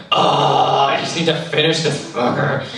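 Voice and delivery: exasperated groan into a mildly angry grumble; mock rage. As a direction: act out fed-up rage, half in jest. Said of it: "just the rage part".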